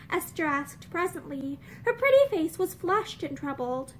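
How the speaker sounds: usual speaking voice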